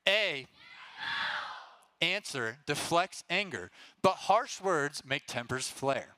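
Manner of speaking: slow, deliberate, leaving a gap before "answer"; fill-in-the-blank reading. Shown as a demonstration. Leader reading the proverb slowly so the youth fill in the blank. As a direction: read it slowly and pause for the group to shout the missing word. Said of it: "Your word comes quick, so be ready".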